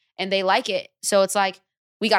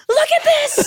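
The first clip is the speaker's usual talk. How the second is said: much higher and louder, bursting with news; excited squeal; 8 dB louder, slower than usual. Retold or imitated. Friend on FaceTime showing the Lisa video, squealing. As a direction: shriek it like you can't hold the news in.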